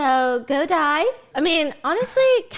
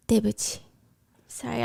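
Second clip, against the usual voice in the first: quiet apology; 2 semitones lower, 10 dB quieter than usual. Real, not acted. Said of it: "watching this with your parents".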